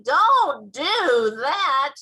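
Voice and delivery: slow, loud, stretched-out protest; drawn-out shouted scolding; yelling. A narrator's reading. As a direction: yell each word long and hard. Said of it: "yelled the B F G".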